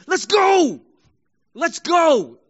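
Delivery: loud, high sports cheer; rallying shout. Acted. Hosanna in modern language, shouted to the team. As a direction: shout it like a fired-up fan. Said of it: "Hosanna was something like".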